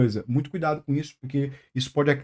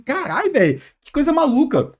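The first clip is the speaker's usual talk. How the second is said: exasperated; 8 semitones higher than usual. Retold or imitated. Cursing about caller on Twitter.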